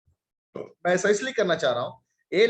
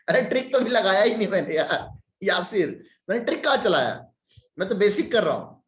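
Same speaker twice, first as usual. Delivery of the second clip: protesting surprise; 5 semitones higher than usual. Real, not acted. Protesting he used no trick.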